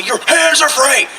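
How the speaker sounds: high, urgent escalation; high-pitched mock urging; insistent inner voice. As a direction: push it like an urgent realization. Voice in his head grows insistent.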